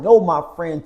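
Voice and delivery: usual speaking voice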